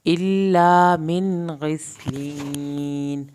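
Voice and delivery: stretched tajweed recitation; Quran recitation. Shown as a demonstration. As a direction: chant it out slowly with a full heavy ṭā. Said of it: "من ہوگا".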